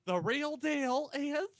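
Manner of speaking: slow, sassy Southern drawl; sassy southerner accent. Acted. Reading the real deal on risk, sassy Southern accent. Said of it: "This is his sassy southerner accent".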